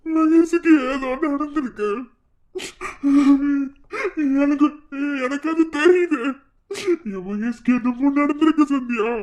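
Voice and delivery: high, loud sobbing plea; crying. Acted. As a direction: break down crying for him. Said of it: "சின்ன பிள்ளை மாதிரி அழுதுகிட்ட".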